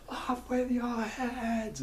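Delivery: high, drawn-out royal decree; Red Queen. Retold or imitated. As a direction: stretch it out slowly in a high mock-queen cry. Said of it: "And then she's like".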